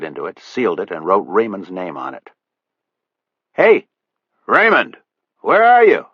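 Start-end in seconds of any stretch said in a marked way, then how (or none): 3.5-6.1 louder, higher call across the boat. A narrator's reading. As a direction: call out to the boy inside. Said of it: "he called out".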